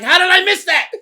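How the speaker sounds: high, loud anguished outcry; frustrated outcry. Retold or imitated. Told he missed the crash, high loud anguished outcry. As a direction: wail it at the sky.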